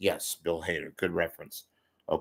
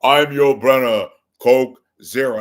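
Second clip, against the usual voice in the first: loud, raised, emphatic actor impression; Yul Brynner; 5 semitones higher, 13 dB louder than usual. Host showing his other impression, big declaiming actor voice. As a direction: announce yourself grandly and forcefully. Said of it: "That's my other impression".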